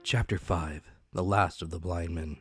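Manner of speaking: ordinary delivery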